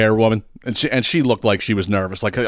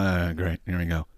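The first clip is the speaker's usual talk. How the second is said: low, much softer muttered groan of defeat; deflated low grumble; weary resignation; 5 semitones lower, 10 dB quieter than usual. Retold or imitated. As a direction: sag into it under your breath. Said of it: "I'm like".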